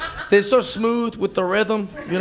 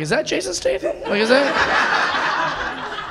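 higher, breathy amazed question; awed disbelief; 7 semitones higher, much slower than usual. Retold or imitated. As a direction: marvel at the hero. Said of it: "We're like".

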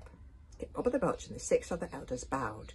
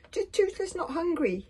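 high, loud little dragon voice; Toothless; 13 semitones higher, 6 dB louder than usual. Acted. Small dragon refusing the dare, squeaky. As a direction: squeak it like a balky creature.

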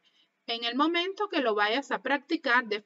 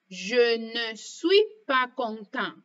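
slow, careful je ne suis pas content; teacher demo, slowed right down; slow model sentence; slower than usual. Shown as a demonstration. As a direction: say each word apart for learners. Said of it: "Por ejemplo".

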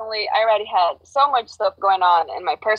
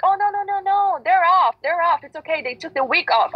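much higher, breezy brush-off; dismissive mock voice; 9 semitones higher than usual. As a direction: wave it away like a smug manager. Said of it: "And then they're like".

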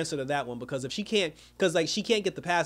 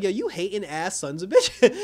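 higher, louder laughing insult; mock angry outburst; 5 semitones higher, 6 dB louder than usual. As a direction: fire it off half laughing, mad for Vic. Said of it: "I was mad for Vic too".

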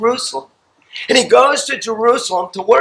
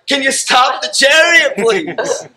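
breathless Philip; 5 semitones higher, slower than usual. Retold or imitated.

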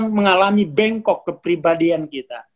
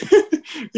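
laugh; 8 semitones higher than usual. Real, not acted. Laughs after listener's remark.

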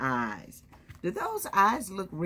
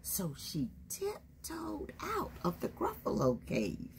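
hushed, high storybook whisper; 10 semitones higher, 10 dB quieter than usual. Acted. Reading to the kids as the child tiptoes, whispered. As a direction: whisper it like sneaking past a sleeping monster.

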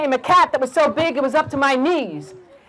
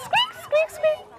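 very high-pitched little squeaks; mouse squeaking; 5 dB quieter, slower than usual. Acted. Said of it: "they said it in mouse".

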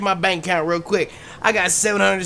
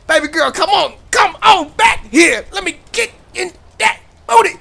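frantic shout; 9 semitones higher, 5 dB louder than usual. Real, not acted. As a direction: holler after her, desperate. Chasing a jogger in game.

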